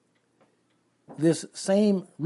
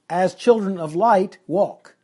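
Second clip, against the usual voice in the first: put-on "As children of light" pushed louder, then "walk"; emphatic fronting; 3 semitones higher, 6 dB louder than usual. Shown as a demonstration. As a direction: stress all of "As children of light" before "walk". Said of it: "it's like this".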